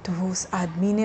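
ordinary delivery, nothing marked

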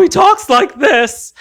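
lightly voiced high, slow Ed Wynn; a hint of Ed Wynn's wobbly comic voice; Ed Wynn impression. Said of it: "he sounds like Ed Wynn".

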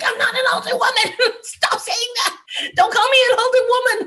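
high-pitched, laughing outrage; mock indignant protest. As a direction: protest loudly in comic exasperation. Acts out annoyed retort to boyfriend.

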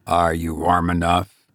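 low, tender man's voice. A narrator's reading. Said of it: "he asked tenderly".

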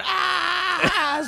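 very high anguished yell. Retold or imitated. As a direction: cry out in despair, full force.

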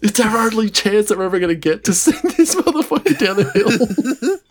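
hammed-up fury at a ruined chance; mock outraged yelling. Players tackling the mate who stopped the ball, mock outraged yelling. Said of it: "like, wailing him".

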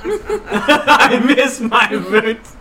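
slow, high wail breaking into laughter; badly faked sobbing, giggling as you go; fake crying. Player repeating a cry-accent turn, mock weeping with laughs. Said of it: "The cry accent".

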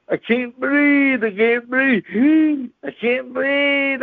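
slow, drawn-out gasping plea; mock hollering. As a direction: stretch it like you're out of air from laughing. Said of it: "And he's hollering".

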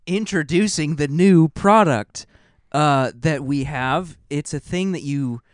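loud, high ad-pitch voice; commercial announcer. Acted. Improvising a product commercial, loud announcer voice. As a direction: sell it like a TV ad. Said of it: "You have to do a commercial".

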